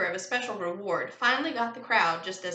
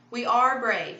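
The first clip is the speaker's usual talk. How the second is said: louder, higher protest; insistent; 4 semitones higher, 6 dB louder, slower than usual. A narrator's reading. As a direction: insist on it firmly. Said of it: "Hugo insisted".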